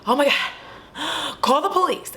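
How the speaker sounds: high, drawn-out cry for help; mock panic. Girl begs the others for help, in mock panic. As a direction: act out a frantic plea, high-pitched.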